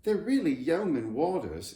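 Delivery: a knowing little explanation; Judy lightly voiced; explaining girl. A narrator's reading. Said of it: "explained Judy".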